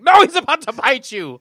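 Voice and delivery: high, loud panicked warning; mock warning. Mock warning about Nosferatu.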